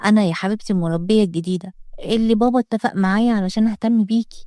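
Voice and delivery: ordinary delivery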